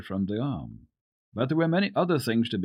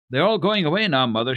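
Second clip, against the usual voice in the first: high, louder excited report; excited child's news; 7 semitones higher, 7 dB louder, faster than usual. Acted. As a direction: burst in with the news. Peter's mother voiced, excited news.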